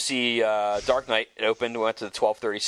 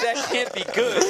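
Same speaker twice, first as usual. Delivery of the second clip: higher, slower, breaking into a laugh; frantic laughing disbelief; 11 semitones higher than usual. Retold or imitated. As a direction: laugh through the words in mock panic. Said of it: "And I'm going crazy".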